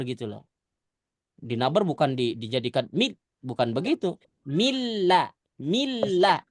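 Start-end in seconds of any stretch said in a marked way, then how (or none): 4.4-6.4 Milla said crisp and firm, not swayed; firm reading. Shown as a demonstration. Teacher shows the firm reading to students. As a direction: clip milla cleanly. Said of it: "Jadi bacanya tegas gitu ya".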